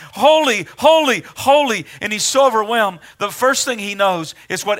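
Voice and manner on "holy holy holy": called out high and loud, each holy ringing; crying out holy. Acted. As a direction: proclaim it like angels calling across the temple. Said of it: "they're crying out".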